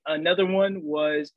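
normal talking voice

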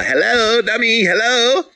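high, loud, teasing hello; mock wake-up call. Teasing a dummy who missed the message, high mock call. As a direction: call out shrilly to someone being dense.

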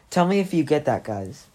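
plain reading voice

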